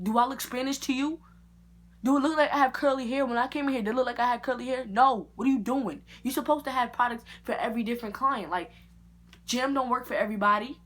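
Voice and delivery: loud, high indignant rant; angry scolding. Retold or imitated. Scolding the barber over the wrong gel, a loud angry rant. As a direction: tell him off, fed up.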